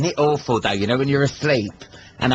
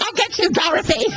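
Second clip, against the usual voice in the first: much higher cackling witch threat; wicked witch. Retold or imitated. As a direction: play the witch menacing Dorothy.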